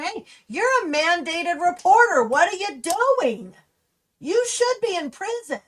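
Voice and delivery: loudly telling off someone who should have reported; angry retold callout. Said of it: "I'm super pissed off going".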